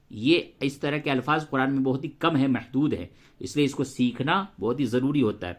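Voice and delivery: usual speaking voice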